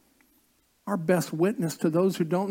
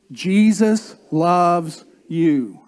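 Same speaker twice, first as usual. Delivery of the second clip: slower and louder, really meaning it; louder, slower second Jesus loves you; emphatic repeat; 6 dB louder, much slower than usual. Shown as a demonstration. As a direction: say it again to your neighbour, slow and loud. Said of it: "turn to them and say".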